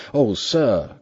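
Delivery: loud pleading cry of protest. A narrator's reading. Butler protesting to the lawyer, loud cry. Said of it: "cried Poole".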